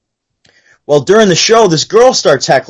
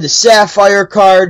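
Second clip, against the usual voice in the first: normal talking voice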